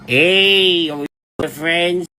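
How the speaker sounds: high, drawn-out mock hey; mimicked friend-seeker. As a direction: parody a needy would-be friend. Mimics friend-seeker once more.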